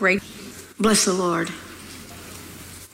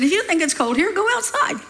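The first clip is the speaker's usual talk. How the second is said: dad's comeback, pitched up at the end; retold dad's retort; 8 semitones higher than usual. Dad answering his daughter's cold complaint, lightly imitated retort. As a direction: lightly voice a dad, rising on the last words. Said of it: "He said".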